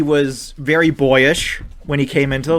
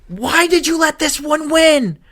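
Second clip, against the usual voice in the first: exasperated rant; 11 semitones higher than usual. Retold or imitated. Fan berating quarterback.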